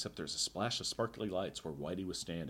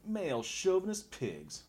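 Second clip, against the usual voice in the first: indignant Julie; 10 semitones higher, slower than usual. Acted. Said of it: "I'll be Julie".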